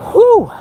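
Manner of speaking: loud, high whooped exhale; whew. Real, not acted. Winded walker resting, loud high whew. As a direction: blow out a piercing "whew".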